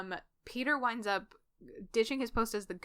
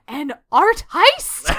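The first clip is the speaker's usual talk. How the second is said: much louder, higher, slow delighted "An art heist?"; thrilled exclamation; 7 semitones higher, 18 dB louder, much slower than usual. Acted. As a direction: sell the big reveal with glee. Reading own fic line aloud.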